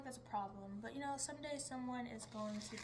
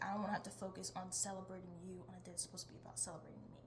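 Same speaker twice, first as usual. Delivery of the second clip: ordinary talk, nothing marked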